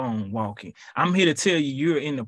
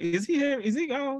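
mock peeking question; 11 semitones higher, 4 dB quieter than usual. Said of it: "you really like".

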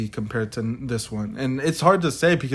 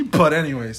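normal talking voice

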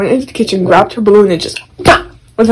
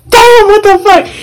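high, loud outraged cry; mock pained yelp; 14 semitones higher, 5 dB louder than usual. Teen being slapped by mom, pained outcry. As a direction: cry out like you've been hit. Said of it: "And I'm like".

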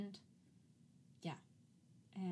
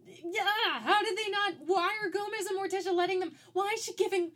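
high and loud, piling up questions; worked-up movie rant; exasperated rant; 11 semitones higher, 8 dB louder than usual. Real, not acted. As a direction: rant at the screen in disbelief. Ranting at the parents' choices.

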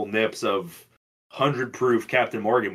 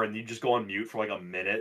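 normal talking voice

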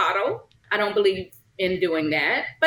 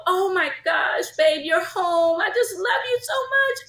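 mock gushing sweetheart; 7 semitones higher than usual. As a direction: ham up an overly sweet partner. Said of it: "We're not the type that go".

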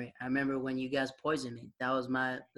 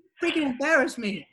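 high, louder retold complaint; mock outrage; 10 semitones higher, 5 dB louder than usual. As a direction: imitate someone indignant after being pranked. Retold outburst of embarrassed prank victim.

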